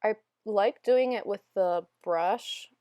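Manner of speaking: usual speaking voice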